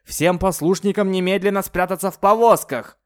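high, loud commanding shout. Acted. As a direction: bellow the order. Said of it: "закричал так громко".

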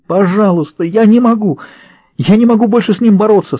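high desperate cry; breaking into a scream. A narrator's reading. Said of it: "сорвалась на крик Рэйчел".

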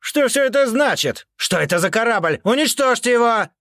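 shouting. A narrator's reading. Count demands the ship be destroyed.

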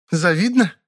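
high, quick comeback; parrying retort. A narrator's reading. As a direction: toss it back. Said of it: "парировала та". (парируя)

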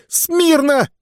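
very high, strained yelled order; full shout. Acted. Sergeant's third try at the command, a strained high yell. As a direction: yell it with everything you have. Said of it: "Не так!".

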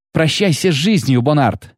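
understated squeal of defiance; mild shriek. A narrator's reading. Girl threatens the bounty hunter, a mild shriek. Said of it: "взвизгнула она".

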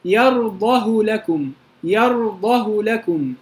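slow يرضه with a short u on the ه; short damma reading. Shown as a demonstration. Teacher shows the qasr reading, short u after يرضه. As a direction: recite يرضه لكم, keeping the final u of يرضه short. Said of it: "ضمة بس".

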